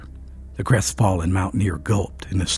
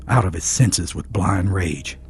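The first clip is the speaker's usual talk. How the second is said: ordinary delivery, nothing marked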